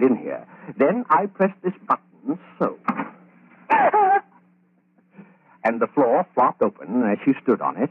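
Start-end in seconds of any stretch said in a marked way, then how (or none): 3.7-4.2 a sudden high cackle of glee; evil high laugh. Acted. Killer laughs to Betty, a high evil cackle. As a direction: cackle at the memory of the trap.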